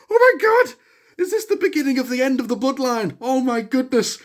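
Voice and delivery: shocked fan reaction; stunned amazement. Retold or imitated. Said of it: "I'm like".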